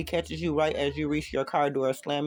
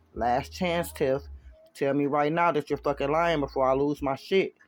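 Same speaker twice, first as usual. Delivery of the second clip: ordinary delivery, nothing marked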